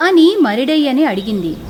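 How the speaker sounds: ordinary delivery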